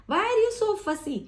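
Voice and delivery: high, slow, mock-annoyed ask; exasperated question. Shown as a demonstration. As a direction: scold a picky eater lightly. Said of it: "demo of question tone". (ऊँची, धीमी, हल्की झुंझलाहट भरी पूछ)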